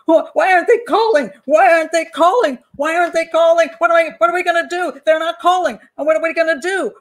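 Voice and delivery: high, loud panicked cries at the police; frantic pretend panic over the missing call; hysterical yelling. Parent yelling at police in panic when the ransom call never comes. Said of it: "I'm gonna start yelling at the police".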